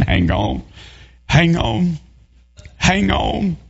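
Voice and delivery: hushed, inward hang on; preacher praying alone for the swimmer; silent crying plea. Retold or imitated. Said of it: "sat there crying in my heart".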